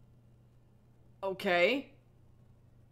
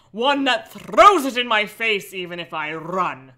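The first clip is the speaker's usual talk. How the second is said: louder, earnest melodramatic read; dramatic story reading; 5 dB louder than usual. A narrator's reading. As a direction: read it like angsty teen prose.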